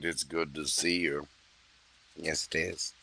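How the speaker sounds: murmured with quiet relish; Hannibal Lecter. Acted. To the client Evelyn as Hannibal, hushed and menacing. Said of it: "Hannibal Lecter".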